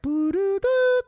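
high, loud "boo-doo-doo" beeps; imitated phone tone. Telling friends how the dead number sounded, imitated phone tone. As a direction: sing out the error tone.

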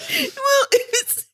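high laughing voice. Real, not acted. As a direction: try to speak while laughing. Still cracking up.

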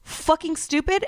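retold rage. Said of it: "I got enraged and I started screaming at him".

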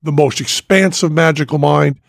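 ordinary talk

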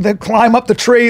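usual speaking voice

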